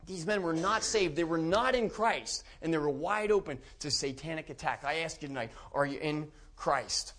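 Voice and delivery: usual speaking voice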